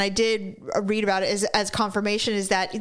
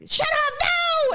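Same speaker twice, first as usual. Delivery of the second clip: imitated pained yell; slower than usual. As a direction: yell it like the heat just hit. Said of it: "and he's like".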